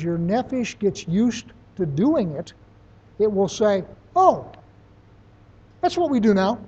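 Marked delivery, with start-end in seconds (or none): 4.2-6.6 higher, louder "Oh" of discovery; dawning realization. Acted. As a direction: suddenly catch on, lightly acted. Voicing the nephesh catching on.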